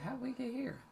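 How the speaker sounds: whispered wonder at the talk's turn; amazed whisper. Retold or imitated. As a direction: breathe it in disbelief. Amazed at where talk landed.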